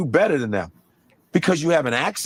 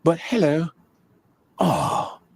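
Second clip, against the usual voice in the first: British accent; 2 semitones lower, slower than usual. Shown as a demonstration. Comedian contrasting it with the American accent, British accent. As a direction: switch to a polite British voice.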